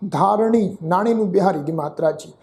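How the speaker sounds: ordinary talk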